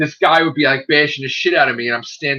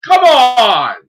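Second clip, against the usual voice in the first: much higher, louder, playful protest; mock pleading shout; 14 semitones higher, 7 dB louder, slower than usual. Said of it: "I'm like".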